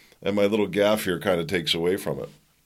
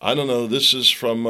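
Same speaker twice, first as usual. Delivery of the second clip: usual speaking voice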